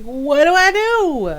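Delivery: high wailed mock panic; freaking out. Streamer retelling failed reboot to viewers, mock freaking out. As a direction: wail it, rising high then dropping. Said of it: "And I'm freaking out".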